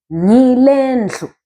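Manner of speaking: slow teacher's demo of "Ngile ndlu"; model pronunciation. Shown as a demonstration. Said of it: "You want to say".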